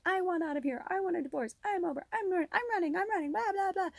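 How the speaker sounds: high, whiny mock of her own rant; hamming up the I-want-a-divorce outburst; mock fight rant. Wife mimicking what she yells at her husband in a fight, high and whiny. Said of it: "every time we have a fight and I'm like".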